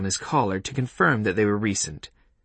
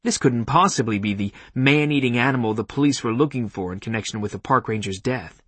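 ordinary delivery, nothing marked

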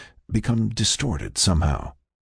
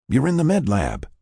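higher-pitched explaining voice; Frank; 8 semitones higher than usual. Acted. Frank tells the patient where she is, higher voice. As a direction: answer her, pitched up.

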